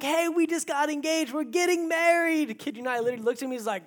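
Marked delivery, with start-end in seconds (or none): 0.0-2.6 high-pitched, excited news-breaking; acted giddy announcement. Newly engaged man grabbing a stranger in the hotel hallway, giddy. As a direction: gush it, stretching the last words. Acting out telling a stranger, thrilled.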